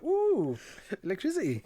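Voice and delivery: high, dreamy ooh of wonder; a child watching sparks in awe; dazzled kid. Retold or imitated. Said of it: "I was like".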